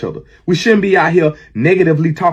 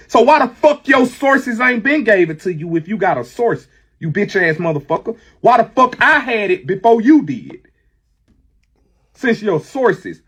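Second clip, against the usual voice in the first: angry challenge; 7 semitones higher than usual. Real, not acted. Furious challenge to a rival.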